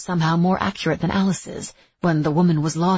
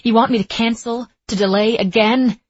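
higher, louder, hurt demand; pained demand; 5 semitones higher, 6 dB louder than usual. A narrator's reading. Etta confronts her instructor about delaying, in a pained demand. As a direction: demand it, fighting to hold back the pain. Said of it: "she demanded, trying to keep the pain".

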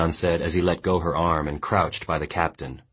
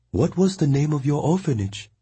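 higher, steady woman's voice; Mongnan consoling; 10 semitones higher than usual. A narrator's reading. Mongnan firmly consoling the weeping boy. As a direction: tell him firmly he died well.

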